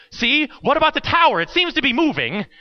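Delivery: high-pitched, alarmed what-about; mock panic. Scared boy feels the tower shifting, mock panic. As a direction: imitate a kid fretting as the floor moves.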